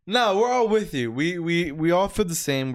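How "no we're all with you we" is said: ordinary delivery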